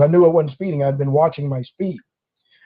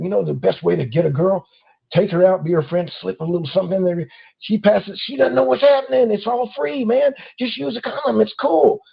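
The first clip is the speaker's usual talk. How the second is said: voicing a sleazy friend; 3 semitones higher than usual. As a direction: play the smug buddy selling a bad idea. Friend voiced giving vile advice.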